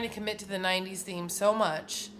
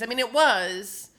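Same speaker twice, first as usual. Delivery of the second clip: normal talking voice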